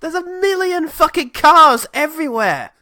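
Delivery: high, loud panicked complaint; hammed-up panic in traffic; mock panic.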